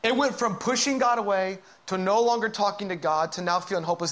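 normal talking voice

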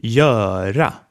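Göra, long open ö held before the r. Shown as a demonstration. Teacher repeating Göra for the class, open vowel. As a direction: draw out the ö in Göra. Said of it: "the long open ö".

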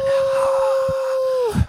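high cheering whoop; cheering woo. Pretend. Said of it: "round of applause".

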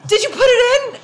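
high, loud retold yell; indignant yell. Driver running over to the ticket writer, yelling. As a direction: yell the question across at them, high-pitched. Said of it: "I always wanna yell at them".

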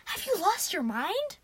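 high, drawn-out incredulous cry; stretched, high-pitched outrage; screaming in disbelief. A narrator's reading. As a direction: cry out as if they've gone mad. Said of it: "Sadie screamed".

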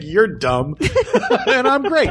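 smug, laughing sarcasm; sarcastic smug. Retold or imitated. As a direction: sneer it like a smug jerk. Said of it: "a guy who's just sarcastic".

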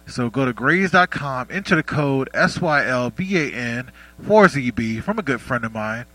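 loud, upbeat sponsor read. Acted. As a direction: sell it like a radio spot.

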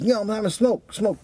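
mock a caught thief making excuses; a hint of a guilty junkie; thief's feeble excuse.

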